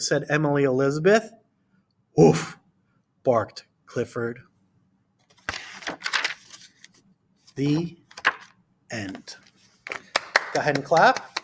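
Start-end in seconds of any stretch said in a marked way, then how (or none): 0.0-9.4 put-on reading to children. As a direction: read it to a class of small kids. Reads picture book aloud.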